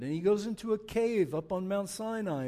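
usual speaking voice

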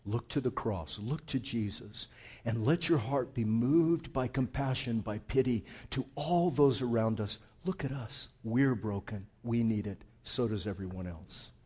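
usual speaking voice

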